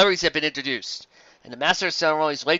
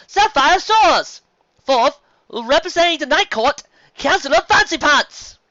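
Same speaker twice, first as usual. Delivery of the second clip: high, loud booming emcee; announcer voice; 14 semitones higher, 9 dB louder than usual. A narrator's reading. Master of Ceremonies announcing judges to the crowd, booming. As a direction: call it out like a ceremony host.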